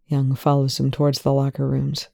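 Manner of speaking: plain reading voice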